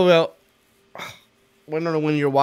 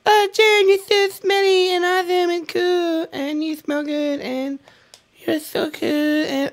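much higher, breathless mock fangirl; gushing fan voice. Host voicing an adoring viewer praising him, high mock gush. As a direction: squeal the praise like a starstruck admirer. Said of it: "So you're like".

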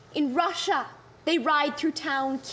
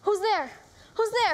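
higher, startled call into the dark; frightened challenge, raised pitch; alarmed; 4 semitones higher than usual. Acted. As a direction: demand to know who is there, on edge. Woman hears an intruder at night.

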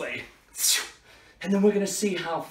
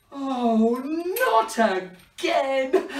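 high, very drawn-out mock wail; mock despair; 6 semitones higher, 3 dB louder, much slower than usual. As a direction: wail it slowly in hammed-up despair. Wand breaks once more.

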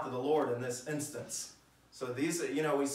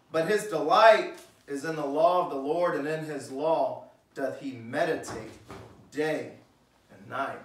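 reads the verse loud and strong; scripture reading; 5 dB louder than usual. Acted. Preacher reading verse two aloud, strong and full. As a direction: lift up the law of the Lord.

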